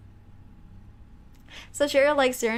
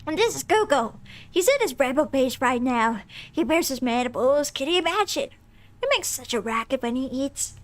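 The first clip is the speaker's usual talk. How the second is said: Lady Mance voice. Acted. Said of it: "doing her voice".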